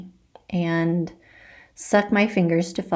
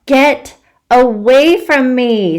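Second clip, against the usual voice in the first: loud, slow screaming get away; 6 semitones higher, 9 dB louder, slower than usual. Acted. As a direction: shriek at the pest to back off. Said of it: "screams Violet".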